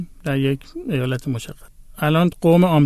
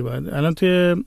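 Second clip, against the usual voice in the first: normal talking voice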